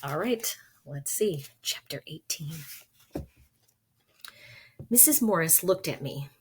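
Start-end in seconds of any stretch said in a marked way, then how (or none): 1.6-2.5 whisper. Real, not acted. Reading title before story.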